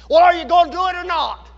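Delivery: wife's blunt retort. Retold or imitated. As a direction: put the wife's demand bluntly and loudly. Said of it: "This was her response to me".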